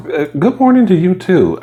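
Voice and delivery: high sarcastic greeting. Retold or imitated. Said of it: "to myself".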